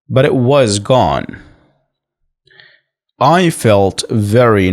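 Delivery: very slow, deliberate model reading; repeat-after-me reading. Shown as a demonstration. Teacher reads the topic for learners to repeat, slow and measured. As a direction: read slowly phrase by phrase, leaving long gaps to repeat. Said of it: "I'm going to read the topic again, and I need you to repeat after me".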